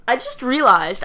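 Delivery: usual speaking voice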